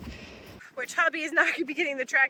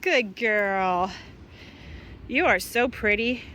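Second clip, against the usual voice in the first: ordinary talk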